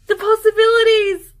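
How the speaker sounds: high, loud, near-tearful cry of delight; overjoyed. Real, not acted. Overwhelmed by gifted yarn, a high emotional cry of joy. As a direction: gush it like it's too much.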